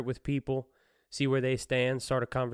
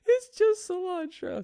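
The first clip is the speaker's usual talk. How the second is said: laughing mock plea; slower than usual. Still voicing the woman.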